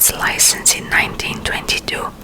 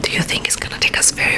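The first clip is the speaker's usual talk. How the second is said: ordinary talk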